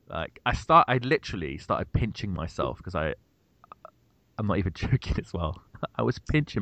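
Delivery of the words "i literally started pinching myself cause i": normal talking voice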